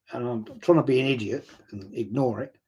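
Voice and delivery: normal talking voice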